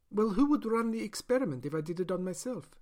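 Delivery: a doctor justifying her experiment; doctor's voice. Acted. Scientist doctor defends her experiment.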